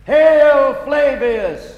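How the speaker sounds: loud slow proclamation; shout. Acted. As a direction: hail it out grandly. Crowd cheer.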